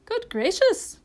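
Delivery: high, startled exclamation; astonished cry. A narrator's reading. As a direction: exclaim in amazement. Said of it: "cried the doctor".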